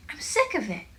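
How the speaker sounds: high, exasperated cry; fed-up outburst. A narrator's reading. Joel has had it with sister's praise, fed up. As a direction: drop the gushing for a high grumble.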